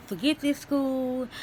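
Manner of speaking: mock frustrated quit; imagined upset outburst. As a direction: drag out a pretend fed-up outburst. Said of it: "I coulda said".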